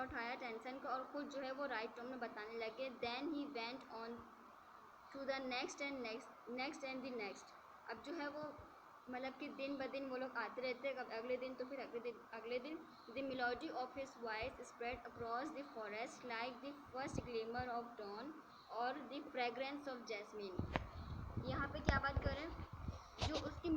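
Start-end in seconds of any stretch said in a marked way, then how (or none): 3.0-7.5 slow, measured textbook reading; story read-aloud. A narrator's reading. Teacher reads English story to students, slow measured read-aloud. 13.2-20.6 slow, measured textbook reading; story read-aloud. A narrator's reading. Teacher reads English story to students, slow measured read-aloud.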